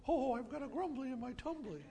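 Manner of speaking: a light hint of soft Winnie the Pooh; Winnie the Pooh impression. Pastor joking to the congregation in a soft Pooh voice. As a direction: mumble it lightly like a sleepy bear. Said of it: "my best Winnie the Pooh imitation".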